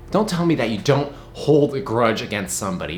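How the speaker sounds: normal talking voice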